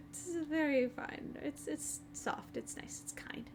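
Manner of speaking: very soft, slow airy whisper; soft snake voice. Acted. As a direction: breathe it softly like a gentle snake. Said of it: "I wanna hear a snake voice".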